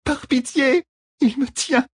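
high desperate woman's voice; under torture. A narrator's reading. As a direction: plead high, caught and suffering. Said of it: "implora-t-elle".

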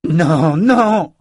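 loud, high wail of denial; anguished cry. A narrator's reading. Richard kneels by dead Callan, loud anguished cry.

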